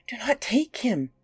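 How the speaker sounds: a high, pleading cry of please; begging. Acted. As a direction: beg the monster desperately. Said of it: "I begged".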